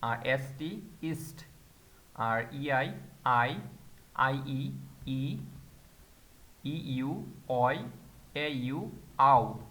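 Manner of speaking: slow, drawn-out sounds for each letter pair; teacher slowly modelling German sounds; German letter combinations. Shown as a demonstration. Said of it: "কিছু উচ্চারণ যেমন".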